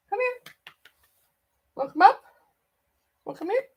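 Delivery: high pet voice calling a cat. Real, not acted. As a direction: warn the cat, then coax him over. Scolding then coaxing the cat.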